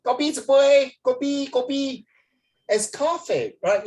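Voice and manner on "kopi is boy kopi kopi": high Singaporean uncle Singlish; Singaporean uncle accent. Retold or imitated. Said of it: "Singaporean uncle will say".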